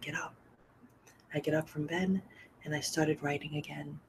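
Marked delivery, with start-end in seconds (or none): 0.0-0.3 soft, breathy retelling of a whisper; gently whispered urging; retold whisper. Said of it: "Kevin is just whispering".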